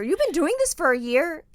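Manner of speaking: loud, high disbelief; mock astonished old voice; incredulous. In-law shocked at the news.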